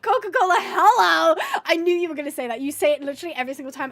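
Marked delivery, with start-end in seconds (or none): 0.0-1.4 loud, higher-pitched imitation of a friend's catchphrase; Emma. Streamer copies friend's usual line, loud hammed-up imitation. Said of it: "Emma usually goes".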